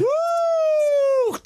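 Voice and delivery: high excited whoop; cheer. Real, not acted. Host cheering the live performance, excited whoop. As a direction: whoop it up for the band.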